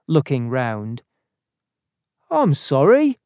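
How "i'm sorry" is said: raised, loud apology; apologetic ticket man. A narrator's reading. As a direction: ticket man calling an apology, pitched high.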